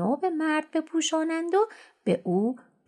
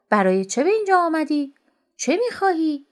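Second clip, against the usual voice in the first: higher, louder sharp question; harsh demand; 6 semitones higher, 4 dB louder than usual. A narrator's reading. Rich miser snaps at the beggar at his door, higher and louder. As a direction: snap at him to state his business. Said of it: "وقتی مرد فقیر را دید با تندی گفت".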